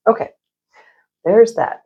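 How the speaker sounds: normal talking voice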